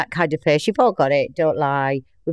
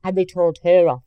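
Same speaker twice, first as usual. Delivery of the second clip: ordinary talk, nothing marked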